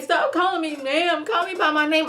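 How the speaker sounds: high-pitched imitated complaint; indignant protest. Woman objecting to being called ma'am, indignant imitated protest. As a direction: mimic her objecting, pitched well up.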